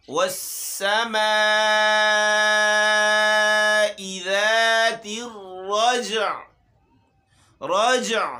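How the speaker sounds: tajweed recitation. Shown as a demonstration. Teacher recites the verse again with the ع clear. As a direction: stretch والسماء and sound the ع. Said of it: "عین کو بھی ظاہر کرنا ہے".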